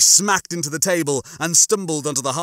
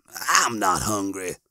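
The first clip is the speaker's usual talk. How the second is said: slow, lower, reluctant reply; half-hearted; 5 semitones lower, slower than usual. A narrator's reading. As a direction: refuse half-heartedly, drawing it out. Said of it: "half-hearted dialogue with the hunger pain".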